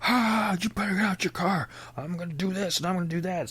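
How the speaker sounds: high, put-on angry trucker yelling; a lightly voiced angry stranger at the car window; mock trucker yell. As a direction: ham up a threatening biker. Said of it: "the guy's yelling outside".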